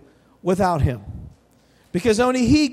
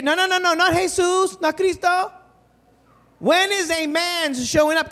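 put-on higher insistent child; kid voice; 7 semitones higher than usual. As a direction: correct him like a stubborn small boy. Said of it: "the little kid's like".

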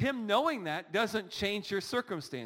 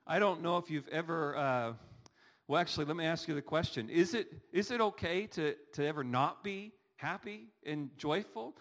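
normal talking voice

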